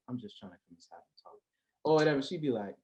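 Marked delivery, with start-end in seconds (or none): 0.1-1.3 much softer seductive line; smooth line. Retold or imitated. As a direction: murmur the pickup line. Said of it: "done hit the".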